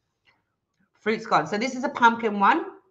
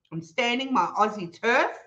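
usual speaking voice

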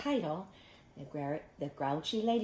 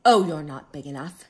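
loud, scoffing brush-off; grouchy bluff with a big oh; grouchy ladybug; 16 dB louder than usual. Acted. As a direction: dismiss the rival with bluster. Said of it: "said the grouchy ladybug".

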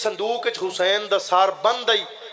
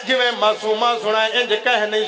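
full-force preaching; 4 semitones higher than usual. Acted. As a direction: drive the majlis to its peak. (ਪੂਰੇ ਜੋਸ਼ ਨਾਲ ਜ਼ਾਕਰੀ)

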